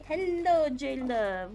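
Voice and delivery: normal talking voice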